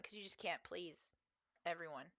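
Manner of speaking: normal talking voice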